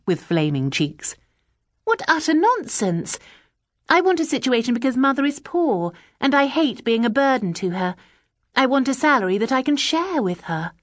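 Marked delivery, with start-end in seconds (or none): 1.8-10.7 a lightly voiced earnest girl; eager young Bella. A narrator's reading. Young Bella answering the agency woman, lightly eager. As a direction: keep it bright and willing.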